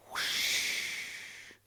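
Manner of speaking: rushing air sound; whispered whoosh; breathy whoosh. Acted. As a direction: swoop down with a whoosh. Falcon swoop sound.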